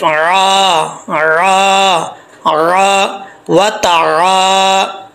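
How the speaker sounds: तारा with a clear आइन and a long अलिफ, very slow; clear ain, stretched. Shown as a demonstration. Teacher drills the ain with its alif, slowly. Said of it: "आइन ज़बर अलिफ".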